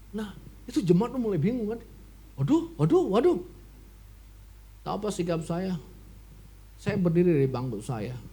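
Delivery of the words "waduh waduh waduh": usual speaking voice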